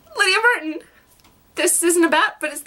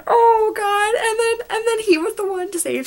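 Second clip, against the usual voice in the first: higher, tearful catch; choked up; 9 semitones higher than usual. Real, not acted. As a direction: voice catching in a cry. Overcome by emotion about Liam.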